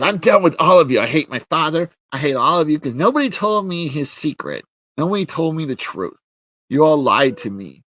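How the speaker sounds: hammed-up furious protest; mock angry Teela. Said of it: "Teela gets mad".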